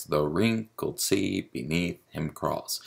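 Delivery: measured beat on "The wrinkled sea beneath him crawls", each stress lengthened; showing the stresses. Shown as a demonstration. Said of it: "that generally is the stress".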